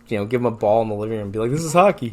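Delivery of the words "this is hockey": mock parent voice.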